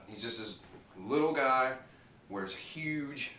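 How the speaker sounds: ordinary talk, nothing marked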